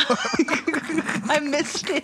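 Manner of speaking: words stretched out through laughter; helpless real laughter through the words; laughing. Real, not acted. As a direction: say it while cracking up. Both cracking up at show.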